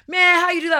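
loud, high amazed outburst; freaking out. Retold or imitated. Celebrity to the self-styled psychic, freaking out loudly. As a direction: imitate a man astonished by a trick. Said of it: "they all start freaking out".

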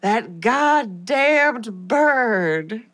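very slow, every word stretched out; hammed-up dramatic punchline. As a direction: draw it out with mock dread. Punchline of dramatic review reading.

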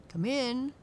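loud, high friendly dog voice. Acted. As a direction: welcome them like a big friendly dog. Peterkins the dog greets the dolls.

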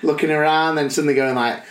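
ordinary delivery

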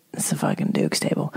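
low conspiratorial whisper, no voice. Retold or imitated. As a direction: hiss it secretly to a friend at the table. Telling Tom about the Duke.

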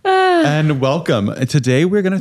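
radio voice. Acted. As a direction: restart in the radio voice. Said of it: "That was a good start".

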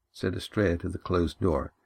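ordinary delivery

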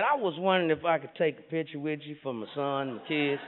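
low, soft sheepish cop; shy cop fan. Retold or imitated. Low soft cop asking the comedian for a picture. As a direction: ask for a photo almost under the breath.

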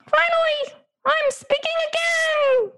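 very high squeaky talking dog voice. Acted. Podcast host voicing her dog for the interviewer, very high. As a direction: pitch way up into a silly little dog. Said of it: "but I'll do it for you".

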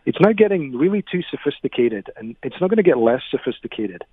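ordinary talk

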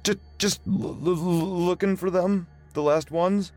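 a scared man tripping over words; frightened stammer. Acted. Joe stammers at gunpoint.

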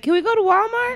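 put-on child voice. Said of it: "was like".

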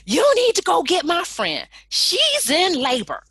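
high, loud urgent yell; urgent yelling friend. Retold or imitated. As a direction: shout at him to go now. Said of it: "my best friend jumps in".

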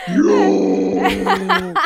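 long, loud growly "yeah"; caveman growl. Retold or imitated. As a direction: bellow it like a macho caveman. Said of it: "caveman growly sound".

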